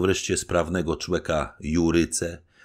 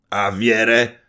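higher, louder indignant outburst; grumbling officer; 8 semitones higher, 11 dB louder, slower than usual. A narrator's reading. Officers fume at the gates, loud indignant complaint. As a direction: bristle with anger. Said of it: "powtarzał ten i ów".

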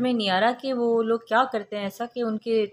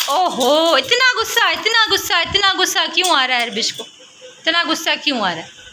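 high coaxing mom voice; 8 semitones higher, 3 dB louder than usual. Real, not acted. Mother coaxing her angry daughter to draw, high and sing-song.

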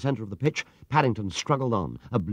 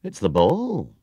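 startled discovery in a character voice; astonished exclamation; 4 dB louder, slower than usual. A narrator's reading. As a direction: exclaim it as a sudden find. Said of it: "he exclaimed, withdrawing his hand".

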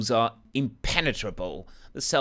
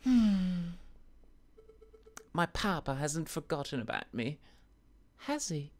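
1 lady's voice; 7 semitones higher, 5 dB quieter than usual. Acted. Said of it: "what kind of voice I should do".

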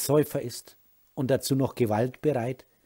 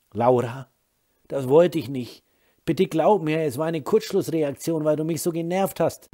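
tearful pleading; 5 semitones higher, 5 dB louder than usual. Acted. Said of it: "fing an zu heulen".

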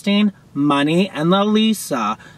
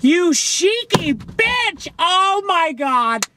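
high, slow drawn-out shriek of delight; 11 semitones higher, much slower than usual. Real, not acted. Fan thrilled by [name]'s cheeky sign, high drawn-out shriek.

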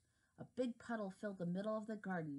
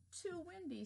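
high complaining worm voice; 10 semitones higher, 4 dB quieter than usual. Acted. Character complains in story.

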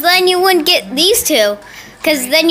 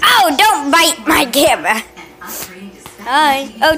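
ordinary talk

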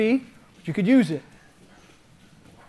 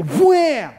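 high, loud impact sound; sudden punch sound effect; shouted wham; 7 semitones higher, 7 dB louder than usual. Shown as a demonstration. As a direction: yell the strike as it lands. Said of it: "I go".

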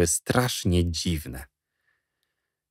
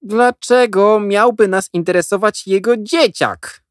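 high, louder, slow scoff; dismissive question; 13 semitones higher, 5 dB louder than usual. A narrator's reading. Avery dismissing Jack's news, high and slow. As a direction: brush it off. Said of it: "dopytywał Avery".

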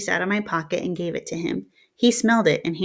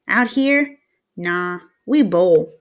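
put-on casual town boy; 4 dB louder, much slower than usual. Town boy brushing off the gang question, slow and offhand.

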